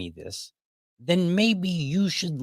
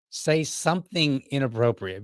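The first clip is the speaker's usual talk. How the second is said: ordinary talk, nothing marked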